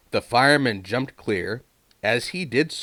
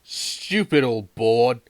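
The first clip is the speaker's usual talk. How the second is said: cheeky engine voice; 3 semitones higher, 4 dB louder, slower than usual. A narrator's reading. Thomas voiced in story.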